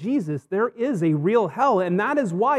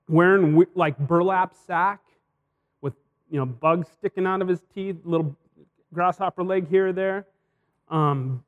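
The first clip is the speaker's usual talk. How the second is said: normal talking voice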